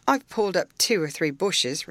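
lightly voiced country lad; a touch of rural dialect; young rustic man. A narrator's reading. Young man owning up to his master, lightly rustic.

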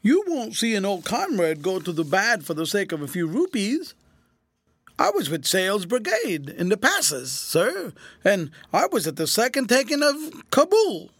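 high, wheedling whine; cringing. A narrator's reading. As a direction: plead as an old comrade. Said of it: "said the cringing mendicant".

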